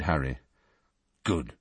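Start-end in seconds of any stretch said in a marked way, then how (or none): 1.2-1.5 low curt grunt. A narrator's reading. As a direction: grunt it out, sullen. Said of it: "grunted Harry".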